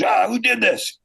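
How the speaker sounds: a retold boss, lightly voiced; startled old boss. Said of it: "he goes".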